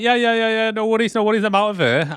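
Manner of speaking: high-pitched, eager brush-off; played-up eagerness to leave; hasty agreement. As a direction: agree repeatedly and make an exit. Frenchie eagerly leaving, acted.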